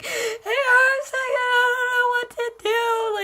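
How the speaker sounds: very high, drawn-out mock wail; bawling, acted out. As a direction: wail it loudly like a bawling child. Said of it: "bawling my eyes out".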